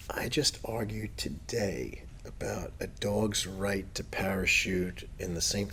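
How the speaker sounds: hammed-up quiet protester reflection; mock protester musing. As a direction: muse it back quietly like a tired protester. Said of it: "be like".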